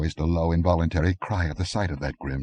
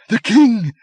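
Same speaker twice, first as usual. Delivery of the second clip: much higher, louder shriek; 10 dB louder than usual. A narrator's reading. Countess recognising the king, a shriek. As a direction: scream it out in disbelief. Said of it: "shrieked Albiona".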